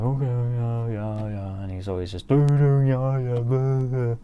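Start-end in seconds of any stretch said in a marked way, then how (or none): 0.0-1.6 dopey droning mock of a friend; imitating a buddy brushing off advice; mocking imitation. Mocking friend's dismissive replies. 2.3-4.2 loud, higher dopey babble; hammed-up brush-off, all blah; mocking imitation. Mocking friend's dismissive babble.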